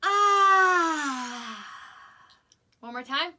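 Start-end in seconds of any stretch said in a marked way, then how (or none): 0.0-1.7 loud sighing shout, higher and louder. Shown as a demonstration. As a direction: drop the shoulders and let out a big sigh. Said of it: "sigh louder and louder".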